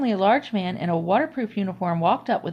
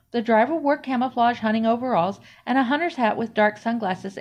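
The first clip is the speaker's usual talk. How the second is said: ordinary delivery, nothing marked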